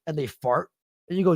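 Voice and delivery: usual speaking voice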